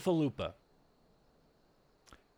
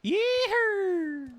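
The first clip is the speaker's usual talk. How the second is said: yeehaw; 4 dB louder than usual. Real, not acted. Gaming celebration shout.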